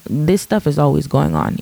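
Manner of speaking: ordinary delivery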